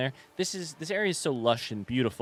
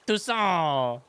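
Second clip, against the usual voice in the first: higher, drawn-out mock proclamation; milk the silly name for laughs; playfully grand, stretched-out Toussaint; hammed-up place name; 5 semitones higher, 3 dB louder than usual. Repeats the funny name for laughs.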